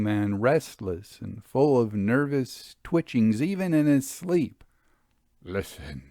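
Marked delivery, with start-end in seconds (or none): 5.4-6.0 low, hushed, barely voiced listen; urgent hushed warning; almost inaudible. A narrator's reading. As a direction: breathe it so the other can barely hear. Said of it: "said Harry to me almost inaudibly".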